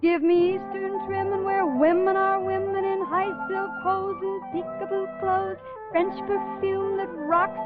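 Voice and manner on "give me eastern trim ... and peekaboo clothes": normal talking voice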